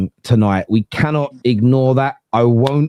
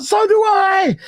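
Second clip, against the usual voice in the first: excited shout; slower than usual. Retold or imitated. Lads agreeing all at once in a high yell. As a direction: blurt it out together, high. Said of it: "all the lads were like".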